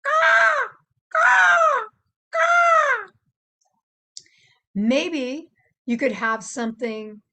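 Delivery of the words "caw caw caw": high, loud shouted caw; crow caw. Shown as a demonstration. Showing the kids a crow's loud caw. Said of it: "Or maybe even a crow".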